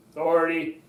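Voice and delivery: low, firm delivery; authoritative. Shown as a demonstration. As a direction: drop it down for authority. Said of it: "keep your voice low for authority".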